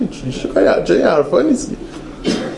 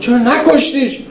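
mild mock angry yell; 8 semitones higher, slower than usual. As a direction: toss the rebuke up high, half-hearted. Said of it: "با عصبانیت داد زد".

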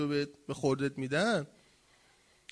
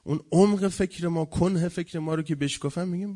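ordinary talk, nothing marked

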